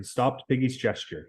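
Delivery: ordinary delivery, nothing marked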